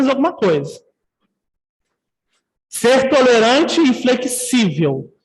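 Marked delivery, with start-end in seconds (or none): 2.7-5.1 slow, deliberate, for the notes; slow emphatic rule. Shown as a demonstration. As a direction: spell the rule out word by word. Stating the key rule slowly.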